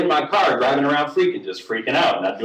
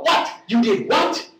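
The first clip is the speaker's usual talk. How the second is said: incredulous outburst; 9 semitones higher than usual. Retold or imitated. Joe incredulous on the phone.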